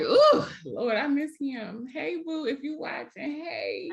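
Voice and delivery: high, lovesick calling out to an ex; high swooning call to an old flame; playful swooning. Retold or imitated. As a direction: coo it like you still miss him. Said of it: "So I was like".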